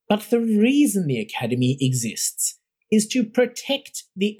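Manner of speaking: cold imperious lecture to the faculty; stern imperious Roth. Acted. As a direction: talk down to the room from on high. Narrator voicing Roth lecturing.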